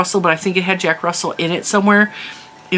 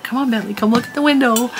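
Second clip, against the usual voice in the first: higher, sing-song voice for the dog; coaxing the dog; 7 semitones higher, faster than usual. Retold or imitated. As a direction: coax the puppy over, sweet and high. Calling her dog to the window.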